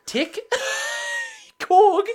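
high, loud mock Kiwi roll call with a laugh; hammed-up New Zealand accent, names shouted out. Mimicking the girl naming the squad, New Zealand accent. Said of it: "She says it in a New Zealand accent".

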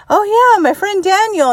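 imitating her son, high and casual; breezy kid. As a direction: toss it off like a carefree child. Imitating child's casual remark.

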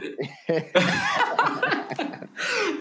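long, high laugh; high-pitched laughing fit. Real, not acted. Speaker cracking up at the host's joke, high long laughter.

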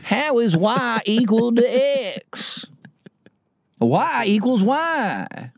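very high, slow Nebraskan caricature; baffled student in a silly, high Nebraska accent; mock Nebraska accent. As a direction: drag it out in a squeaky fake Nebraska accent. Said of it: "what the accent is in Nebraska".

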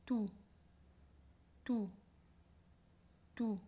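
tú with unaspirated t and tight closed u; tense closed u. Shown as a demonstration. Teacher models tú for English-speaking learners. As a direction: keep tú crisp and tense. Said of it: "no se aspira y la U aquí, eh, bien cerrada y tensa".

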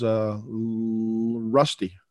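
slow, stuck on the L, then Rusty slips out; stuttering block. Retold or imitated. Boy asked his name, stuttering on it. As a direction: relive the block on the L before blurting Rusty. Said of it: "I really struggled".

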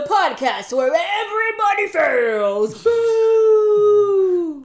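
loud, high, slow shouted announcement; hyped-up tagline. Pretend. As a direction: shout it like a hype man. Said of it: "it would have to be more hyped up".

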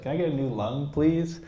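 high-pitched, innocent little ask; mock pleading request. Mock request voicing the uncle.

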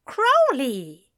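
high, drawn-out mock scolding. A narrator's reading. Alistair chiding Crowley for rudeness, amused scolding. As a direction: scold while holding back a smile. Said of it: "Alistair scolded".

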